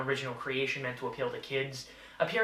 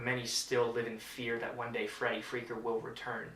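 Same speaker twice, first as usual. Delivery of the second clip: normal talking voice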